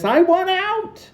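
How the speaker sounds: high, loud, drawn-out showbiz cry; theatrical outcry. Acted. Fed-up assistant announcing her exit to the reader, high theatrical cry. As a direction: belt it like a dramatic exit line. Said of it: "as they say in show business".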